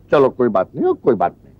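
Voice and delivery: very low, soothing murmur; resigned low reassurance; consoling. Acted. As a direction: drop the scolding and comfort gently, deep in pitch. Consoles daughter after scolding.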